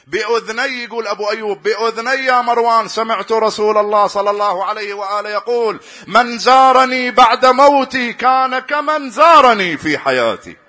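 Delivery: high, loud, slowing into the quoted hadith; preacher climbing to full force with the hadith; fervent preaching. Acted. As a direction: build to a passionate peak on the quote. Quoting the Prophet, rising fervor.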